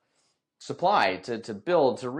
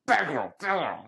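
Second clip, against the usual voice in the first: scolding the dog; 7 semitones higher, slower than usual. Retold or imitated.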